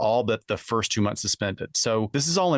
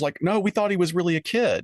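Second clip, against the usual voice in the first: mock excuse; 9 semitones higher than usual. Cohost voicing the men's claim, mock protest. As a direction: play their fake innocence.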